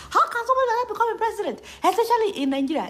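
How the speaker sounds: fast, high disbelieving question; rapid incredulous question. Real, not acted. Vlogger questioning how a forger became president. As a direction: rush it out in disbelief. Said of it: "seriously embarrassed".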